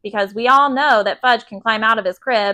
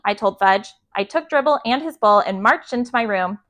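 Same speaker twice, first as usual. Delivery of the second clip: usual speaking voice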